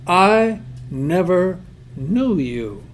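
very slow, grave words; solemn, drawn-out judgment; slow, grave verdict. Retold or imitated. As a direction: lay it down slowly like a sentence. God's final rejection.

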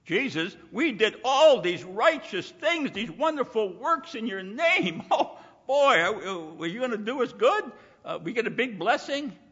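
high, loud, self-satisfied pleading; mock boastful believers laughing at their own good works; pleading hypocrites. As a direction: ham up smug do-gooders begging for reward. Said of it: "That's what they told Jesus".

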